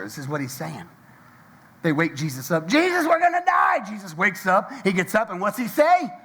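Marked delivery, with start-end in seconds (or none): 2.7-3.8 terrified disciples yelling over the storm; panicked cry. Retold or imitated. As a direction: cry out like men about to drown.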